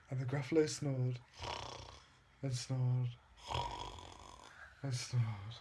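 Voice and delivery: fading softly. A narrator's reading. Sleepy fading bedtime close.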